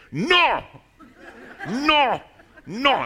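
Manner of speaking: imitated shout at a foreigner, high and drawn out; hammed-up loud, high-pitched refusal; really loud. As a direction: bellow one long word as if volume translates. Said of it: "speaks really loudly and says".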